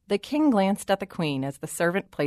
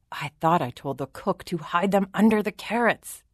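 ordinary delivery, nothing marked

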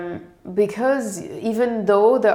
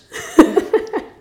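a sudden high airy laugh; amused high-pitched laugh; high breathy laugh; 7 dB louder than usual. Real, not acted. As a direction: burst out laughing at the memory. Laughs at the memory.

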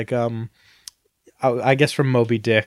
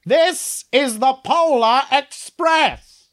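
much higher, very slow hammed-up scream; Tom Hanks screaming; 11 semitones higher, much slower than usual. Doing Tom Hanks screaming the train's name, hammed-up. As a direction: drag the words out in a silly yell. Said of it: "I can do Tom Hanks screaming".

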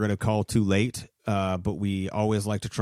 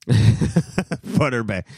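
laughing; 2 semitones higher, 7 dB louder, much slower than usual. Real, not acted. Laughs at the wrong name.

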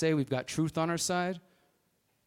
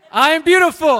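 high, loud shout; booming motivational speech; 13 semitones higher, 19 dB louder than usual. Real, not acted. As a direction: call it out to the crowd. Leading crowd chant.

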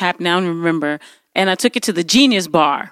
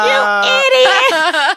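very high, slow drawn-out yell; mock scream; much slower than usual. Shouting at the Genius Bar guy, high mock scream. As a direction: wail the insult way up high. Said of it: "I was, like, screaming".

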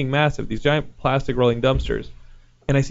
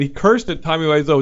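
ordinary talk, nothing marked

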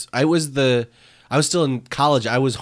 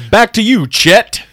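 higher, louder mock anchor sign-off; news anchor handoff; 9 semitones higher, 10 dB louder than usual. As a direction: toss it back like a TV anchor. Hands off like anchor.

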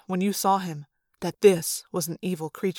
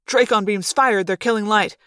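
usual speaking voice

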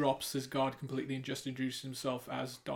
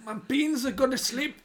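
high, bad Scottish accent; a wobbly attempt at Scottish; switching accents clumsily; 11 semitones higher, 4 dB louder than usual. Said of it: "What accent was that?".

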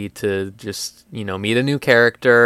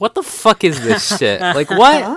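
high, incredulous mock outburst; baffled outburst; 8 semitones higher, 4 dB louder than usual. Fan objecting to a talky sword anime, incredulous. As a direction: protest in loud, high disbelief. Acting out baffled reaction to plot.

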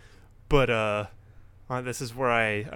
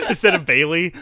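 said through real laughter, louder and higher; laughing; 9 semitones higher, 9 dB louder than usual. Real, not acted. Podcaster amused by own swapped-champion idea, laughing. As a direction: crack up on the words.

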